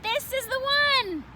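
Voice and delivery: high, slow delighted pick; excited. Acted. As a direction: announce it like he found treasure. Froggy choosing.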